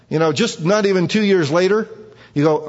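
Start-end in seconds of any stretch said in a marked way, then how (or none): none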